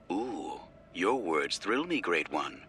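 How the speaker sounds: soft, purring mock admiration; sultry teasing voice. A narrator's reading. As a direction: tease him in a low murmur. Shar's teasing pilot voice.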